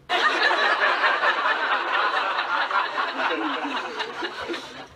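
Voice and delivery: a long high burst of real laughter; loud laugh. Real, not acted. [name] cracking up at his own cow joke, laughing loud.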